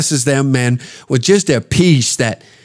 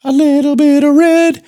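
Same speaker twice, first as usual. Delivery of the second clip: much higher, slow, put-on sing-song old man; mocking old veteran voice; 12 semitones higher, slower than usual. Two old men watching the trains, high slow sing-song mock reminiscence. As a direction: croon it like a doddering old soldier reminiscing. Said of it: "and think to ourselves".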